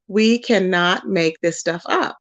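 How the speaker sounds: slow, deliberate, word by word; slow emphasis. Real, not acted. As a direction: spell it out slowly in disbelief. Repeats the line slowly for emphasis.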